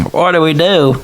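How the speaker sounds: high, drawn-out worried cry; voice from the crowd. Acted. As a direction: wail it like a flustered bystander. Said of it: "somebody else in the crowd going".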